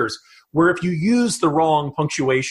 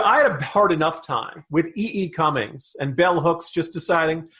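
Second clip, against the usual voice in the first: normal talking voice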